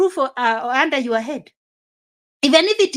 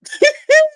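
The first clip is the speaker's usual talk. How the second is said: sudden high, loud laugh; high burst of laughter; 6 dB louder than usual. Real, not acted.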